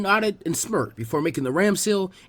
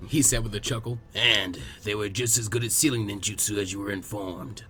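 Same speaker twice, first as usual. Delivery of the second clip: put-on lower, calm old man's voice; gentle, low old village elder; old Hokage; 8 semitones lower, 6 dB quieter than usual. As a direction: speak like a patient elderly leader.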